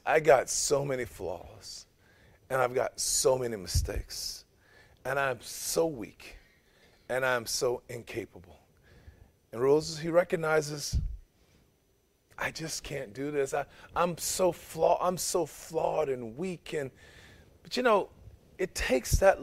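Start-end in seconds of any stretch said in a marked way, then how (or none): 0.1-8.6 soft and slow, listing flaws; humble confession. Acted. Moses admitting his flaws to God, slow and soft. 12.4-16.7 soft and slow, listing flaws; humble confession. Acted. Moses admitting his flaws to God, slow and soft.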